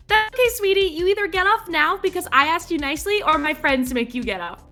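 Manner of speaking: high, sugary, threatening sweetness; sweetly menacing, like a teacher; sweet kindergarten teacher. Pretend.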